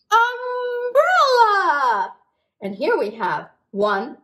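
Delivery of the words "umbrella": high, slow sung-out umbrella; drawn-out umbrella. Acted. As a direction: stretch it out high for the children. Naming word for kids.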